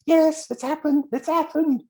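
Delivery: very high, drawn-out jubilant cry; ecstatic. Acted. Caller phoning to say it came true, ecstatic. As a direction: squeal the good news in mock triumph. Retold callers announcing success.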